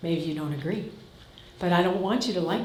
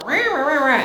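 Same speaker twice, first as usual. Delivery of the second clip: higher, louder mock grumble; mock ranting son; 5 semitones higher, 5 dB louder than usual. Son storming in ranting at mom, mock grumble. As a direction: bluster like a fed-up son storming in. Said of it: "Son's coming in this door".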